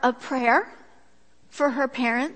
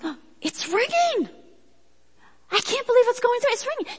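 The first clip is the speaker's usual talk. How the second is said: high, jittery imitation of a nervous friend; 11 semitones higher than usual. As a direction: play her anxious as it rings. Friend voiced nervously as phone rings.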